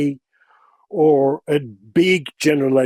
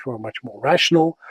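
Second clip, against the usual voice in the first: ordinary delivery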